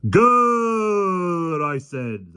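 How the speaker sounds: high drawn-out roar. A narrator's reading. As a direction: roar at the lion. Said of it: "the policeman roared".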